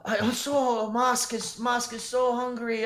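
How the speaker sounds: high, timid goblin stammering in fear; frightened high goblin pleading. Acted. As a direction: squeak it out like a scared little goblin. Goblin begs for food.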